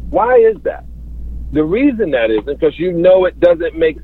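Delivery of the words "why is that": rhetorical disbelief. Real, not acted. Guest asks a rhetorical question.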